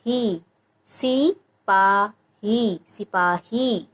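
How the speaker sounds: सी short and sharp, पा and ही stretched long, slowly; syllables drawn out. Shown as a demonstration. Teacher sounds out सिपाही syllable by syllable for parents, slowly. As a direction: sound out सी, पा, ही, slowing right down on the long ही. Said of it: "ह का उच्चारण जो थोड़ा लंबा आएगा".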